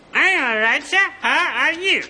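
squeaky ventriloquist dummy. Acted. Ventriloquist demo, dummy's reply.

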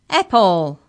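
loud, booming single word. A narrator's reading. Giant offers Mallory a vegetarian option, booming. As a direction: boom the word out. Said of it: "boomed Maury".

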